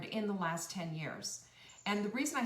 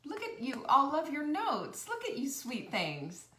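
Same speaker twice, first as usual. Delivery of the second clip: delighted; 6 semitones higher, 4 dB louder than usual. Real, not acted. Reading viewers' notes, touched.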